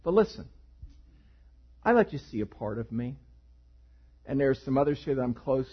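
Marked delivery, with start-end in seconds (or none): none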